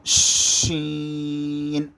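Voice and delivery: شین with a long full sh, ee held on one note; thick whistling شین. Shown as a demonstration. Teacher demonstrating the letter, شین thick and whistling. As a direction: hush thickly into a stretched شین. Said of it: "سی ٹی کی آواز کی طرح موٹی".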